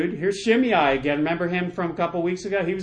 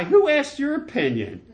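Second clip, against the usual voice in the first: high-pitched, exasperated comeback; mock indignant retort; 9 semitones higher, 3 dB louder than usual. David rebuffs the man urging execution, mock indignant retort. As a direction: swat down unwanted advice in a raised pitch.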